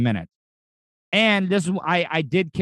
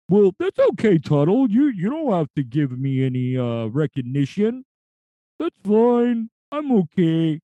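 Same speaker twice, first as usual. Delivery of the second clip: high cartoon vulture voice; 5 semitones higher than usual. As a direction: modest little vulture, pitched up. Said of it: "that's the voice that I'm working on".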